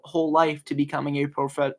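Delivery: usual speaking voice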